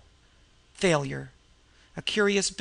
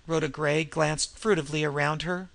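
ordinary delivery

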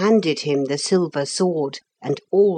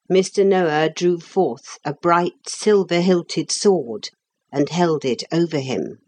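ordinary delivery

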